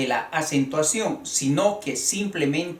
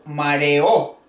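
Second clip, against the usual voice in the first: mareó with a strong final Ó; stress on final O; 3 semitones lower, 4 dB louder than usual. Shown as a demonstration. Teacher introduces a hiatus, mareó stressed on the final O. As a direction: punch the last vowel of mareó. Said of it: "Yo voy a decir mareó".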